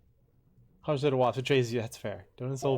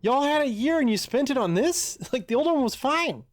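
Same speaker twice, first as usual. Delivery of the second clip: exasperated yelling; 9 semitones higher, 5 dB louder than usual. Real, not acted. Outraged rant at remake.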